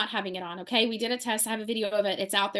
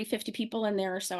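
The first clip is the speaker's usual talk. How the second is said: ordinary delivery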